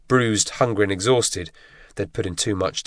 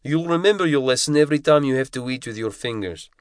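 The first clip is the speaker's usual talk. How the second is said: higher, tight scolding instructor's voice; giant Russian instructor, scolding; 6 semitones higher than usual. A narrator's reading. Instructor scolds ten-year-old Kevin over the spork, harsh scolding. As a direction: tear into the boy like a drill instructor.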